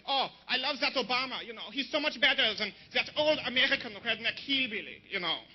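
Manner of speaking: gushing mock German woman; hammed-up German admirer; German accent. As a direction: gush like a German liberal, accent thick. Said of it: "She loves Obama now, too. She's like".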